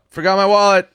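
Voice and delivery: high, loud guilty excuse; nervous answer. Retold or imitated. High son blurting excuse to mom. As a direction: blurt it out too eagerly.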